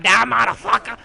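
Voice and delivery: high, loud battle yell; mock yell. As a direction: yell it at an enemy, hammed up. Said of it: "I was yelling at him".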